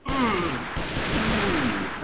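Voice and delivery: high, strained angry "mm"; mock fuming sound; mad grunting noise. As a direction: imitate seething with wordless noises. Imitates a mad younger self.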